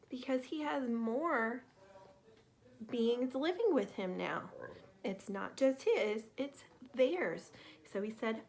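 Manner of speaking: plain reading voice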